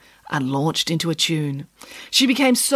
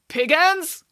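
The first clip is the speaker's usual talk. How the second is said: bored call; 9 semitones higher than usual. A narrator's reading. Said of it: "called a doctor in a bored voice".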